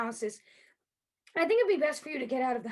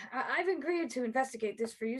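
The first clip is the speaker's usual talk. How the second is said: usual speaking voice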